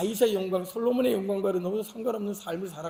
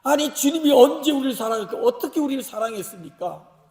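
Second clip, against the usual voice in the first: loud, higher-pitched mock objection opening; protesting; 5 semitones higher, 6 dB louder than usual. Israelites retorting to God's claim of love, protesting. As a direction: burst out in acted indignation, then ease off. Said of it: "이렇게 항변하는 거예요".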